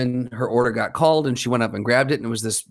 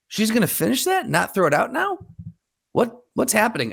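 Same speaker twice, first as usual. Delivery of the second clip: high-pitched, disbelieving inner voice; incredulous; 9 semitones higher than usual. Retold or imitated. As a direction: question it in baffled disbelief, pitched way up. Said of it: "I'm like".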